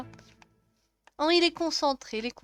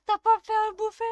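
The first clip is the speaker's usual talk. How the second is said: much higher, louder reproach; nagging Sim; 8 semitones higher, 5 dB louder than usual. Acted. Wife scolding husband for no dinner, higher and louder. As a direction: scold him for not cooking.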